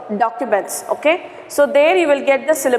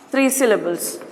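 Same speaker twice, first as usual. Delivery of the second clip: ordinary talk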